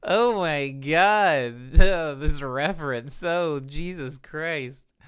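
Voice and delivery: loud, slow laughing exclamations; laughing disbelief. Real, not acted. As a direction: stretch it out in amused shock. Amazed reaction to reference.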